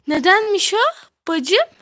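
loud. Real, not acted. Arguing with friends over game.

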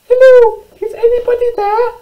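very high, squeaky call into a cave; shouting little mouse. Acted. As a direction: call out loudly as a tiny mouse. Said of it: "she shouted".

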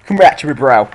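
loud call out. Real, not acted. Gamer recalls his pokemon.

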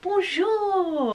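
high, loud hammed-up French voice; sing-song fake French greeting; mock French accent. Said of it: "Very French, isn't it?".

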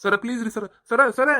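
high, loud cries of sir; desperate begging. Acted. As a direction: call out desperately as you're dragged. Prisoner begs while dragged off.

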